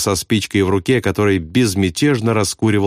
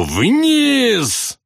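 very high, drawn-out cry; frantic scream; 14 semitones higher than usual. A narrator's reading. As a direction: scream "Вниз" long and high. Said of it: "А потом истошно Маквату".

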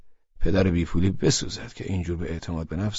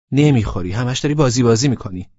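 put-on high, loud girlfriend voice; pitched up as the annoyed girl; girl's voice; 4 semitones higher, 7 dB louder than usual. A narrator's reading. Laleh scolding him for being late, high voice.

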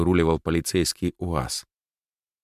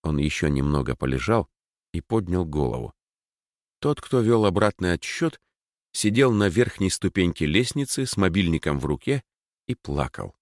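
plain reading voice

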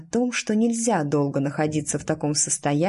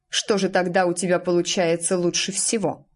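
ordinary delivery

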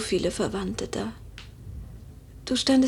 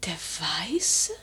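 whisper; 5 semitones lower, 4 dB quieter than usual. Acted. Mistress questions maid about fan.

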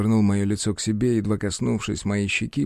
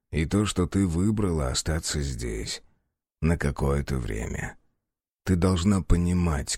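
tender low voice; 8 semitones lower, 4 dB quieter than usual. Acted. Snake's tender low confession.

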